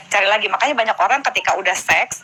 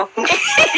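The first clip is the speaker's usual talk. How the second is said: a sudden high delighted laugh; high laugh bursting out; high burst of laughter. Real, not acted. Surprised laugh at the answer.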